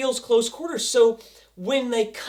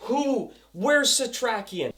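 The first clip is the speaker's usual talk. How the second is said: usual speaking voice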